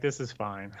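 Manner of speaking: deadpan resignation. Retold or imitated. Skrulls pretending all is fine, understated. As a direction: say it like nothing's wrong. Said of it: "the rest of the Skrulls are like".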